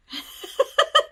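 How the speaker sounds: bright high giggle; a quick squealing laugh. Real, not acted. Laugh after own joke.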